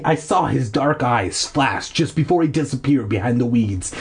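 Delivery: ordinary delivery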